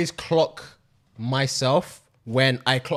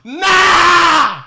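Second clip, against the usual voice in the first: long, high, loud cry of disbelief; outraged shout; 12 semitones higher, 9 dB louder than usual. Real, not acted. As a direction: holler it out, drawn long and high. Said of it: "I'm angry thinking about".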